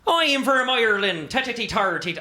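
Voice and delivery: hammed-up high Irish accent; bad Irish impression, squeaky. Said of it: "I can do it pretty good".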